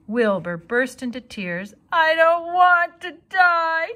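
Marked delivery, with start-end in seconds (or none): 1.9-3.9 high slow tearful moan. Acted. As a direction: moan it through tears. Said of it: "he moaned".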